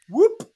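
short, high, loud whoop; imitated whoop. Retelling a grenade gag to friends, imitated whoop. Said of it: "and he goes".

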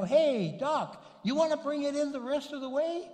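high-pitched, friendly holler; calling out. Retold or imitated. Calls the doctor over to help.

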